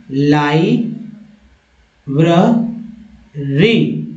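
"लाइब्रेरी" very slowly as lai, bruh, ree; slow model pronunciation. Shown as a demonstration. Teacher building the word for learners, slow model pronunciation. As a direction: stretch and separate each part of "लाइब्रेरी". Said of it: "इसका उच्चारण इस तरह से".

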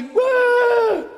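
a high, loud mocking scream of a starstruck fan; mock fan shriek. Preacher mocking screaming fans to his congregation, shrill shriek. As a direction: shriek like a crazed fan at a concert. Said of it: "and you say".

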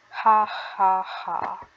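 slow hammed-up villain laugh; ha ha ha like a cartoon baddie; evil laugh.

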